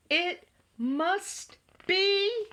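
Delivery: very slow, drawn-out, building to loud; dramatic dread. A narrator's reading. Frightened friends naming the monster, slow building dread. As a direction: stretch every word with ominous suspense.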